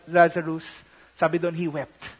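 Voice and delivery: ordinary talk, nothing marked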